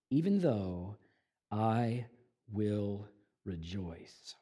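low, soft and very slow; preacher softly slowing on the key line; hushed resolve. Acted. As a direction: drop to a near hush on the promise. Quiet climax of sermon point.

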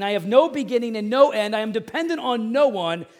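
preaching at full force. Acted. Pastor declaring God's plan to the congregation, loud preaching. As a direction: proclaim it to the whole room.

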